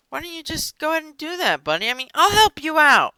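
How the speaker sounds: high, smarmy pitch; fake-friendly salesman. A salesman pretending to be Vlad's friend, high and smarmy. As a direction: sweet-talk him like a fake buddy. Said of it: "So this guy's like".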